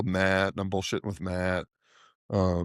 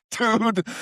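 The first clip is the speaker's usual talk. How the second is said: laughing; 13 semitones higher than usual. Real, not acted. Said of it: "laughing at own story".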